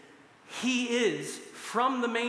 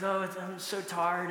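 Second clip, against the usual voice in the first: lightly put-on, bad English accent; terrible English accent; 3 semitones lower, 3 dB quieter than usual. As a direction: attempt a weary Brit with a poor English accent. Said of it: "that's a terrible English accent".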